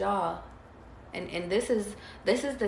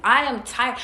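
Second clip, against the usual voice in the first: retold tearful cry, pushed louder; half-crying, louder, as if back in that moment; 4 semitones higher, 6 dB louder than usual. Telling her through tears he is exhausted, louder tearful cry. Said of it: "I was crying tears".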